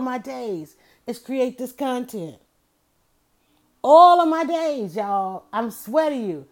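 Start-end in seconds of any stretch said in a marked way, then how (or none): 3.8-4.9 louder and higher, stretched slowly; emphatic loud repeat. Real, not acted. Host to listeners, stressing her lifelong devotion, loud and slow. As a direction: belt out "All of my days" like a vow.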